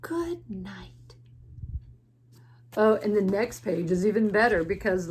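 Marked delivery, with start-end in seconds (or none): 0.0-1.1 slow, hushed whisper; soft whispered bedtime farewell; whispered good night. Acted. Last good night in bedtime story.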